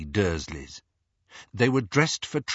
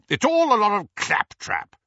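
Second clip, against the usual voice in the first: higher, blustery dismissal; blustering uncle; 11 semitones higher, 4 dB louder than usual. A narrator's reading. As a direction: scoff at the nonsense. Vernon dismissing magic.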